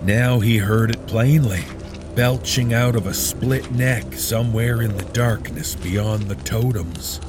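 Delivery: plain reading voice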